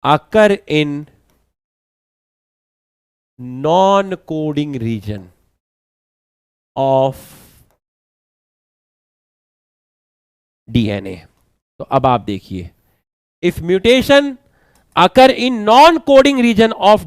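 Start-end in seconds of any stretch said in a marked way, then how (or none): 0.0-11.1 every word spaced out slowly for notes; slow dictation. Shown as a demonstration. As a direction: dictate slowly so students can write. (धीरे-धीरे लिखवाते हुए)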